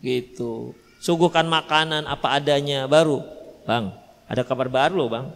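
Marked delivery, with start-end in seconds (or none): 3.6-5.3 much lower, softer sweet lead-in; gentle coaxing. Pretend. Wife gently breaking news to husband after dinner, soft and coaxing. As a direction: ease him into the news kindly. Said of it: "Biarkan dulu masuk, mandi".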